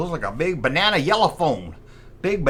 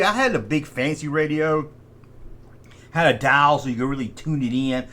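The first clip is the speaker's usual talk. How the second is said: ordinary talk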